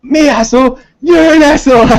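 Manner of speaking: high, loud imitation of the angry hotel manager; hammed-up furious manager yelling back; mock angry yell. Hotel manager yelling at the departing band, mock shout. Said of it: "and he's like".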